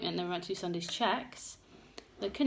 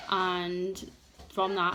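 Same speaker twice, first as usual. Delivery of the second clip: usual speaking voice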